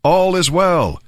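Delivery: high-pitched, mildly projected call; crying out. A narrator's reading. Ahimaaz brings news to the king, crying out. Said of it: "Ahimaaz cried out to the king".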